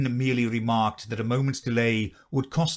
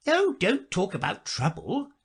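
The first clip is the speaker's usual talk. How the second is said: Duchess voice; 10 semitones higher than usual. A narrator's reading.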